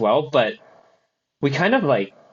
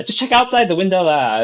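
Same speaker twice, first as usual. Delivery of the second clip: imitating a sassy Singaporean Siri, louder; sassy Singaporean accent; 5 semitones higher, 3 dB louder than usual. Retelling a dubbed Siri video to friends, sassy Singaporean accent. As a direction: put on a lightly sassy Singlish lilt. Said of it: "in like, a Singaporean accent, and it's, like, a little sassy".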